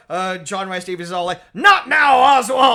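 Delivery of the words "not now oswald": high loud dismissive bark; brusque shout. Retold or imitated. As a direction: snap at him loudly. Father brushing off son.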